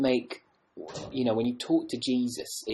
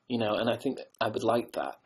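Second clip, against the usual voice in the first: ordinary talk, nothing marked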